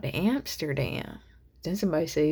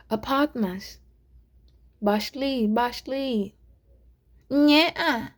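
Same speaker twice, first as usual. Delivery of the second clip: higher, louder mock Russian accent; amateur Russian accent, pitched up; attempted Russian accent; 7 semitones higher, 8 dB louder, much slower than usual. Shown as a demonstration. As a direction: fumble for a Russian accent word by word. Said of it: "let me try to do a Russian accent".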